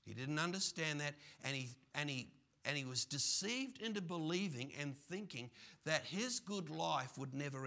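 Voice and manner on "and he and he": ordinary talk, nothing marked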